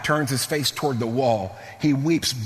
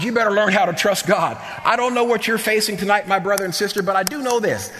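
raised, high-pitched pulpit appeal; preaching exhortation; 5 semitones higher, faster than usual. Acted. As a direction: press the congregation with a lifted preacher's voice. Exhorts congregation at full force.

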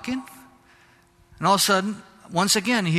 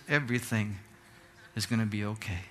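put-on much softer, lower, gentle old man's comfort; soft reassurance; 7 semitones lower, 8 dB quieter, faster than usual. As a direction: hush it down and soothe him, like a kind stranger. Stranger reassures robbed director.

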